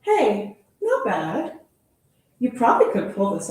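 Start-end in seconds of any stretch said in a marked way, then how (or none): none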